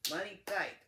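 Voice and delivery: emphatic declaration. Real, not acted. Lecturing viewers about budgeting, loud and emphatic. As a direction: shout the point home, money tight. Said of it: "When it's time to be tight".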